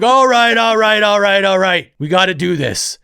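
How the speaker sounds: loud, high rally call that settles down; senior taking charge. Retold or imitated. Joey the older kid rallying the grade nines, loud and cocky. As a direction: pump up the room like a swaggering ringleader. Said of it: "And he's like".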